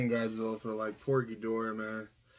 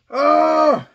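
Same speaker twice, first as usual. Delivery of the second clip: pained shout; 13 semitones higher, 12 dB louder than usual. Real, not acted. Hot sauce pain.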